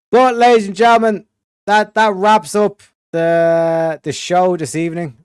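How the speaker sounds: loud showman sign-off; announcer voice. Real, not acted. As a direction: boom it like a host. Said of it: "show-closing announcement".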